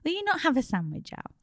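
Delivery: lightly put-on Irish mum offering food; Irish mammy accent. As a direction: coax in a faint Irish lilt. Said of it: "impression of my mum with an Irish accent".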